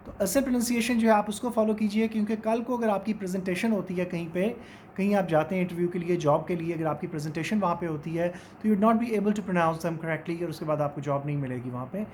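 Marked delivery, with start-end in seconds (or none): none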